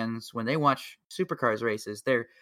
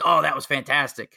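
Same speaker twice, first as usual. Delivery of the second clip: loud mock rave; hammed-up praise, punched out loud; gushing Aussie fans; 3 semitones higher, 7 dB louder than usual. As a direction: imitate an impressed fan, pushing the volume. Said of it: "And the Aussies will be like".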